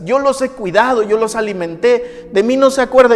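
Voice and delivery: tearful anguish. Retold or imitated. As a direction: plead through the hurt, voice straining up. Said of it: "mi mamá lloraba y lloraba y decía".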